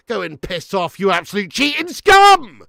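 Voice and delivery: hammed-up angry shout at the screen; mock furious shout. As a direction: bellow the viewer's abuse as if it were your own. Reading a hostile chat comment aloud.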